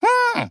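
very high squeaky "hm"; bird-like squeak of a hum; tiny piping hum. A narrator's reading. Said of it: "пропищал нос".

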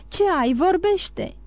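high-pitched, pressing demand; urgent cry. A narrator's reading. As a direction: press hard for an answer. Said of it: "strigă el".

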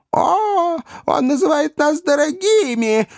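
put-on very high, shrill-pitched old lady cry; shrieking old governess. A narrator's reading. Ugliest old governess shrieks at Pickwick, scandalised and high. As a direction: squeal it like an outraged old governess. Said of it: "воскликнула самая старая и безобразная".

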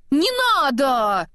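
mild high-pitched yell, drawn out. A narrator's reading. Anna throwing the head back at Kristoff, mild yell. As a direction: stretch it into a high, slow cry of protest. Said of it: "заорала Анна".

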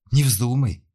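sharp, loud alarmed cry. A narrator's reading. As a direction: blurt it out in a fright. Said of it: "переполошилась Надежда".

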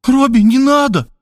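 high, frightened cry of the name; frightened plea. A narrator's reading. Sister clutches his hand and begs him, frightened. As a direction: beg him not to go, scared. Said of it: "Она испуганно схватила меня".

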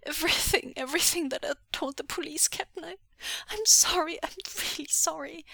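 high, tearful confession; distraught, pleading squeak; choked up. Acted. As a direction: blurt out a choked apology. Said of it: "he choked".

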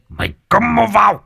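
high, sharp furious hiss; hissed shriek. A narrator's reading. Tôn Thái silencing the begging boy, high furious hiss. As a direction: spit the order out in a high rage. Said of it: "Tôn Thái rít lên".

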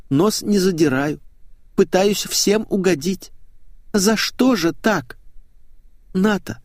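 high-pitched, pleading complaint; wounded young woman. Acted. Hurt woman reproaching her colleague, high plaintive voice. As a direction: play a hurt, self-pitying colleague in a high voice.

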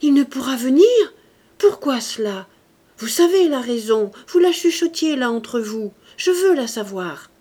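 loud, anguished demand; imperious cry with a heartrending edge. A narrator's reading. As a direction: raise the voice and demand to know. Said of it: "d'une voix haute et avec un accent tout à la fois impérieux et déchirant".

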